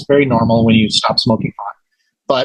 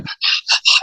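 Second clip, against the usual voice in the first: quick audible panicky breaths, acted out; imitated hyperventilating. Man reliving his epiphany before friends, imitated hyperventilating. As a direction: gasp for air as in a retold panic. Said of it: "I'm hyperventilating".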